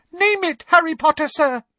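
very high, beaming elf voice; eager elf squeak. Acted. Dobby answering Harry's request, high and eager. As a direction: jump at the chance to help.

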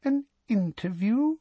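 very high, thin echo of disbelief; squeezed-high incredulous question. A narrator's reading. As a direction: repeat it thinly, pitched right up. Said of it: "her voice thinner and higher than ever".

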